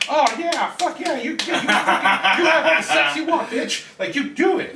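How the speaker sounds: high, loud whooping crowd quote; excited cheering. Retold or imitated. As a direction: cheer her on like a rowdy crowd.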